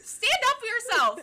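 high, loud egging-on through a laugh; mock exhortation. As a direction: holler it at the victim in comic exasperation. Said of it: "And I'm like".

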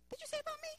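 put-on small, high, soft kid's voice; imitated kid. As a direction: quote a kid in a squeaky little pipe. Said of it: "If you come across a Josie kid".

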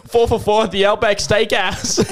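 laughing mock Aussie; hammed-up Australian, amused; Australian accent. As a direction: tag the ad in a put-on Aussie voice. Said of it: "yeah, we'll do Australian".

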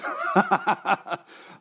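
big unvoiced laugh breaking out; burst of laughter. Real, not acted. Laughing as he reveals the podcast prank to his mate. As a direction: laugh out loud as the wind-up is revealed.

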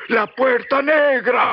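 haunted, slow repetition rising into a cry; delirious murmur. Retold or imitated. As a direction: Mexican guide acting out his feverish grandfather, slow and haunted. Delirious grandfather repeating words.

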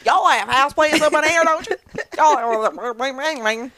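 put-on playful Southern accent; southern twang. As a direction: lay on the y'all twang. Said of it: "This little southern twang".

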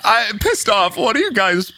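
indignant clown runner. Acted. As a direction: play the wronged clown complaining at the party. GM voices the angry clown.

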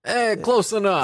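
high, tossed-off Jersey "eh, close enough"; Jersey pizza guy shrug. Pretend. Pizza guy to customer, a high careless Jersey shrug.

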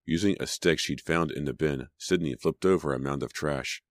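ordinary delivery, nothing marked

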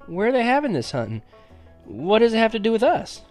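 put-on high, amazed boyish questions; wide-eyed asking. A narrator's reading. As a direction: ask eagerly, pitched well up. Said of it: "Wide-eyed, I asked".

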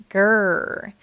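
loud imitated rumbling growl; a mock tummy rumble, voiced; stomach growl. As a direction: growl like a hungry belly. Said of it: "His stomach began to growl loudly".

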